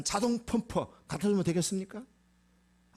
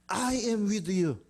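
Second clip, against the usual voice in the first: very slow, raised-pitch proclamation; emphatic declaration; 5 semitones higher, much slower than usual. Acted. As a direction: proclaim it higher, drawing out each word. Promise repeated higher for emphasis. (높은 음으로 천천히 선포하듯)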